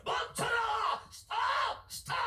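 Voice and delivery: high, drawn-out cries of command; shouted command. Acted. Preacher voicing God halting Saul, urgent shouted command. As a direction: call out a halt at the top of the range. Acting God's command to halt.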